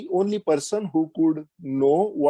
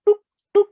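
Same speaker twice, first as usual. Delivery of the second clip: sharp high "tuk" of arrows hitting; arrow sound; 6 dB louder than usual. Retold or imitated. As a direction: pop out the arrow hits like a sound effect. Imitating arrows being shot.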